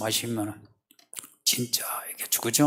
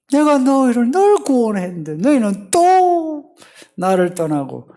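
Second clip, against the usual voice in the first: very high, loud exasperation; wounded reproach; 13 semitones higher, 8 dB louder than usual. Acted. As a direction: cry out as a God left behind once more. Acting God wronged by Israel.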